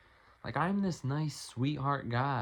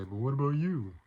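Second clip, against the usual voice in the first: mimicking viewers; 3 semitones higher, 4 dB louder than usual. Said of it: "I know what you guys are gonna say".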